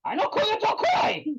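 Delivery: high-pitched mock shriek of the auntie's denial, accented. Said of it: "And he goes".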